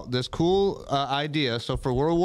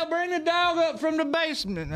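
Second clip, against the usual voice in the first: much higher Southern holler; Southern mom. Pretend. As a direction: holler down to Dale. Hillbilly mom bit again.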